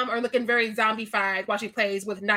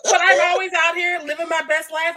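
ordinary talk, nothing marked

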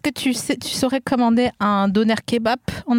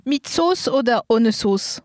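put-on slow kebab-seller German accent; slower than usual. Playing a kebab vendor taking an order, German accent. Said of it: "Tu as pris l'accent allemand?".

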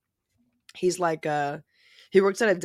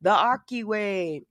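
viral deli guy; 4 semitones higher than usual. Retold or imitated. Podcaster imitating a TikTok-famous deli worker greeting customers, showy. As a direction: imitate an eager deli counterman hawking his catchphrase. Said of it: "this guy in a deli, and he's, like, doing things the Aki way".

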